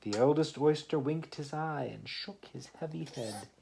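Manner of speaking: lively read-aloud poem voice; story reading. Acted. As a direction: read the rhyme to a small child. Reads poem aloud to child.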